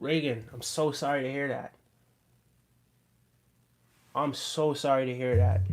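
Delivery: soft, heartfelt condolence; real, hushed sympathy. Real, not acted. Streamer consoling a viewer whose baby is in a coma, hushed and sincere. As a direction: say it very softly, with long pauses.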